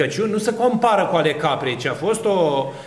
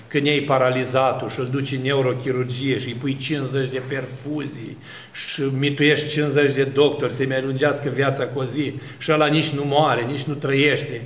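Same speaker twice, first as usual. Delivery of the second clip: ordinary talk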